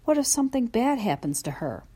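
usual speaking voice

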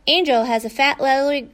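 ordinary delivery, nothing marked

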